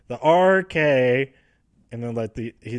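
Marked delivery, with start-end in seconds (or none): 0.1-1.3 slow, loud, stretched-out R K; drawn-out RKO call. Retold or imitated. Randy Orton's promo, retold slow and loud. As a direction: drag the letters out like a wrestler's promo. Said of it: "he says".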